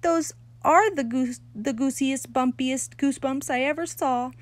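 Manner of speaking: high, loud, syrupy delight; a pleased girl praising her admirer; delighted gushing. A narrator's reading. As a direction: gush over him. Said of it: "Lucille's face beamed very happy".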